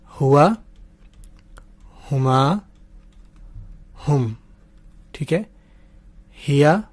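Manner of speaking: lightly voiced drill, each word set apart; teacher modelling the pronouns slowly; slow teaching recitation. Shown as a demonstration. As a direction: recite the chart word by word with long pauses. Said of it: "मेरे साथ पढ़ें".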